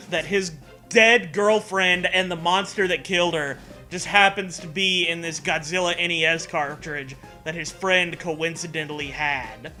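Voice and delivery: ordinary talk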